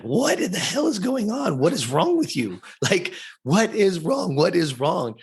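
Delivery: high, frantic retold questioning; mom going crazy. Mom to her sobbing son, going crazy. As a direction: voice a mother in a panic, pitch leaping. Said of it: "she's, like, going crazy".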